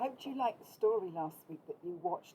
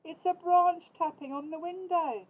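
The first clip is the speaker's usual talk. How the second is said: higher, louder Big Mouse voice; Big Mouse explaining; 9 semitones higher, 6 dB louder than usual. A narrator's reading. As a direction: set the little one straight. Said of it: "he said".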